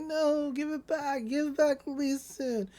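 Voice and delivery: high, soft mock whine; pleading. He steals her chipmunk, she pleads. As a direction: imitate her begging for her toy back.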